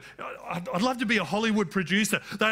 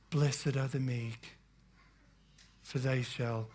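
hushed, low and soft, like a film Jesus; soft movie Jesus; 8 semitones lower, 8 dB quieter than usual. Retold or imitated. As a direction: murmur it gently like a movie Jesus. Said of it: "I don't think Jesus spoke like that".

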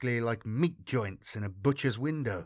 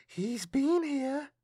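put-on high, slow child voice; eerie little-girl voice, drawn out; 12 semitones higher, much slower than usual. A narrator's reading. Girl sniffs the air beside her mother, high child voice.

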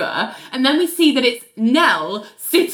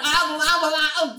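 flustered stammer, pitched up; imitated stammering; 6 semitones higher than usual. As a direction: babble as if lost for words. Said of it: "stammers and goes".

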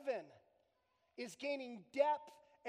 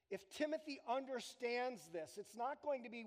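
usual speaking voice

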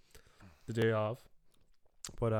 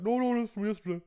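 high, loud, put-on protest; imitated friend's denial; 10 semitones higher, 5 dB louder than usual. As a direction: protest it high, mimicking your mate. Retold reply of cheese-hiding friend.

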